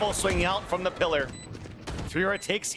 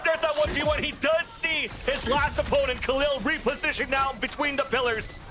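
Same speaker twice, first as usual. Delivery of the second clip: excited shout; 11 semitones higher than usual. Real, not acted. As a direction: scream the clutch win. Clutch play called live.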